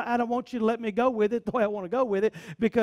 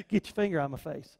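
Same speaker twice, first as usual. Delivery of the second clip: David brushing off; 8 semitones lower, 7 dB quieter, faster than usual. Retold or imitated. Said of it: "And David's like".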